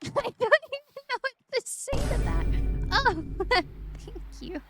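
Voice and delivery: high, loud laughing thanks; laughing gratitude. Real, not acted. Streamer overwhelmed by a viewer's kind gift, laughing high and loud.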